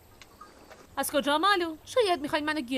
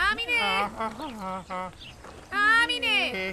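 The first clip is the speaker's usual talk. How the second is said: calling out; 3 semitones lower, slower than usual. Acted. Calling out to sister from afar.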